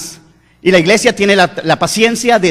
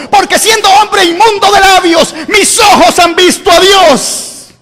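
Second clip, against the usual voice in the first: anguished prophet; 8 semitones higher than usual. Acted. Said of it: "dice el profeta".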